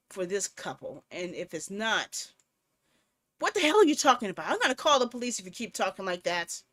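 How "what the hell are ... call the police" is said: loud, fast indignant snap; heated demand; angry outburst. Acted. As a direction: fire it back at the caller. Angry reply to threat.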